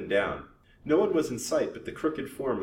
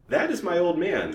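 coolly; 9 semitones higher, 6 dB louder than usual. A narrator's reading.

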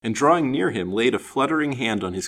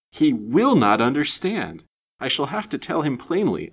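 higher, emphatic exasperation; distracted exasperation; 6 semitones higher, 4 dB louder than usual. A narrator's reading. Woman despairing at his sublime unconsciousness, distracted exasperation. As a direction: fume to yourself, leaning hard on the words. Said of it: "thought the almost distracted woman".

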